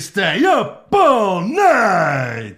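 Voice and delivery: drawn-out, loud, high wail; hammed-up shout. Host hams up innuendo in a sponsor read, wailing it out. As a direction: belt it out, stretching every word.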